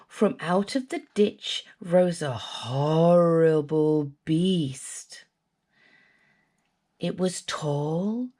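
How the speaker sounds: low, hushed and slow; spooky description. A narrator's reading. Narrator describing the swamp beast, low and creepy.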